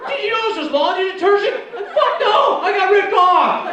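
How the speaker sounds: very high, loud laughing yell; loud laughing shout. Retold or imitated. Cop discovers detergent, comic yells back laughing. Cop's shocked question and reply.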